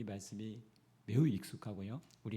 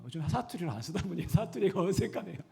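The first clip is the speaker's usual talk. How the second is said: sheepish laugh; 6 semitones higher, 4 dB louder than usual. Real, not acted. Embarrassed about his dialect.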